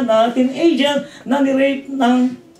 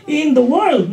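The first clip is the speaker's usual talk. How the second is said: slow, higher, pleading questions about suffering; questioning challenge; 6 semitones higher, slower than usual. Retold or imitated. Coworker Debbie asking why God allows suffering, slow and questioning. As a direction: voice a coworker's doubting challenge, drawn out. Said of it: "sabi niya".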